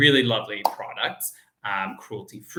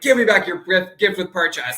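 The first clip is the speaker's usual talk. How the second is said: louder, higher mock cop demand; mock police demand; 7 semitones higher, 8 dB louder than usual. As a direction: play a pushy cop wanting the gift back. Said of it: "they're gonna be like".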